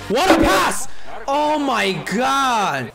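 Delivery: high, loud, drawn-out cheer; excited shout. Real, not acted. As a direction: shout in amazement. Celebrating a pass.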